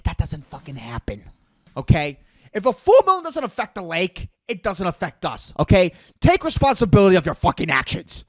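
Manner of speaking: ordinary delivery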